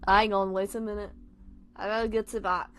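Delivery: usual speaking voice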